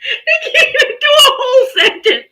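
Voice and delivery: cracking up at the comment; laughing hard. Real, not acted.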